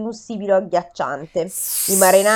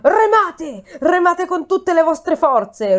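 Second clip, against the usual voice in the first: slow roared order to row, pitched high; roaring; 6 semitones higher, slower than usual. A narrator's reading. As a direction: roar it as a captain's command. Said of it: "ruggì Odisseo".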